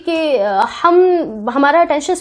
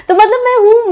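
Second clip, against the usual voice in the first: laughing; 9 semitones higher, 6 dB louder than usual. Real, not acted. Laughs at herself, soft-hearted.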